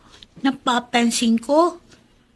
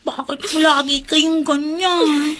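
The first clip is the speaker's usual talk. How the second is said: ordinary talk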